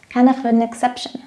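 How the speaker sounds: usual speaking voice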